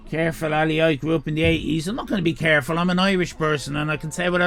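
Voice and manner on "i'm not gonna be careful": usual speaking voice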